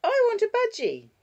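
put-on very high, loud little-girl demand; demanding child. Six-year-old refusing to drop the budgie, demanding child voice. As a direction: insist as a small child, pitched right up.

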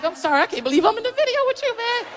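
high, fast starstruck apology; excited apology. Retold or imitated. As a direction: gush an apology to your idol. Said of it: "I'm like".

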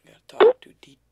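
high breathy whisper. Real, not acted. Youtuber whispering to viewers before the call. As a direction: whisper it under your breath.

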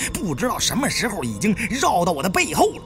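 usual speaking voice

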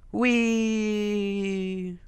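long, high held squeal; playful drawn-out cry; gleeful whee. Real, not acted. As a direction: let it glide gently down like a ride. Gleeful cry during game ride.

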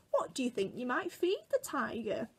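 ordinary delivery

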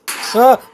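sudden fright cry; startled scream. Real, not acted. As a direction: yelp at the jump scare. Jump scare in game.

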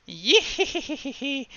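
high, cackling witch laugh; witch cackle. Pretend. Witch mocking the trappers, a high cackle. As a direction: cackle like a fairy-tale hag.

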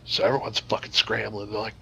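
ordinary talk

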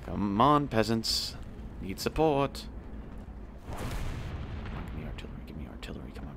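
normal talking voice

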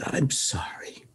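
hushed, slow apology, imitating a crying dad; softly imitated tearful father; retold tearful apology. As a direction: say it slowly under your breath, as if tearful. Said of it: "And he cries".

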